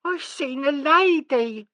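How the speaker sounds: put-on high, slow child voice; sulky child. A narrator's reading. Sissy finally telling, high child voice. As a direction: drag it out like a sullen little girl. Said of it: "said Sissy".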